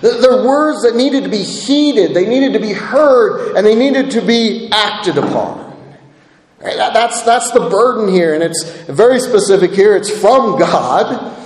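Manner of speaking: ordinary delivery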